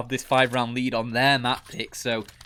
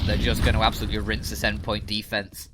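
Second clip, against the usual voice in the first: usual speaking voice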